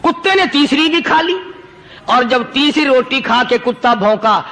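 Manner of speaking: ordinary delivery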